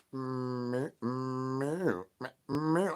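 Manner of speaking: quiet little meows; soft cat meows. Pretend. Streamer playfully meowing softly at his viewers. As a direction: mew softly like a kitten.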